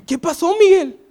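a mother's surprised greeting at the door; mother asks. Retold or imitated. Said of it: "Y, y, y".

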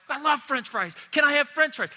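put-on high, excited begging child; whiny kid. Kid at Disney World begging parents, high pleading voice. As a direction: beg for fries like a kid at Disney. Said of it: "and they're like".

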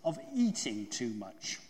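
deliberate storybook scolding, mildly stern. A narrator's reading. Rabbit tells stuck Pooh he overate, stern and slow. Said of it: "said Rabbit sternly".

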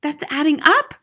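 high, loud schoolboy protest; flustered boy objecting; crying out. A narrator's reading. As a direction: cry out in confusion. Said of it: "Wilford cried".

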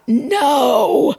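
high, drawn-out cry of refusal; retold scream. Speaker reliving the scream at evil, a high retold scream. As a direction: cry it out high, without much extra volume. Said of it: "I screamed out".

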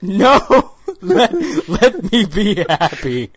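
real laughing plea; laughing protest. Real, not acted. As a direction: beg through laughs.

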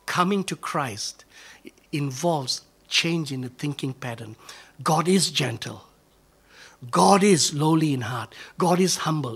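normal talking voice

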